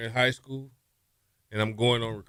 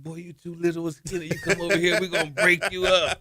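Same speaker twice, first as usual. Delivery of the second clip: higher, scoffing big-talk voice; mocking put-down; 7 semitones higher than usual. Older quarterback laughing off the skinny recruit, scoffing voice. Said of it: "He's like".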